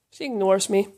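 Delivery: ordinary talk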